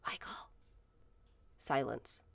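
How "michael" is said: hushed whisper into the dark room. Retold or imitated. Mother whispering her son's name in dark nursery. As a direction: whisper his name so as not to wake him. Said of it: "and I'm like".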